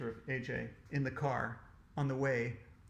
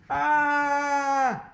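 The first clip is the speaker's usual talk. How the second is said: long, held, high scream of fright; shouted scream; 11 semitones higher, 11 dB louder than usual. A narrator's reading. Boy spots a giant jackhammer, screaming. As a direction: scream "Aaah!" loud and steady. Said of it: "I shouted".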